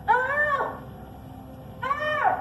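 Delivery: high, drawn-out call to someone elsewhere; hollering. Acted. Woman calling her man up to the attic, hollering. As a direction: holler the name up through the house. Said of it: "What you hollering at me for".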